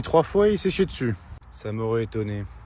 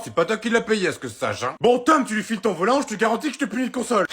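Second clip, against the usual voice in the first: ordinary delivery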